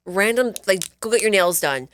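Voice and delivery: usual speaking voice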